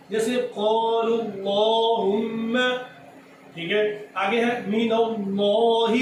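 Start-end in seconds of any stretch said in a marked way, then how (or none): 0.5-2.6 slow recitation, "اللہم" heavy as "llohumm"; dark lam in "اللہم", long held m; thick lam. Shown as a demonstration. Teacher joining the two lams as example, thick lam. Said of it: "موٹا پڑھ رہے ہیں". 4.7-5.9 slow "من اللہ" with heavy, rounded "lloh"; thick lam. Shown as a demonstration. Teacher quizzing on thick or thin, thick lam. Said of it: "اس کو موٹا پڑھیں گے".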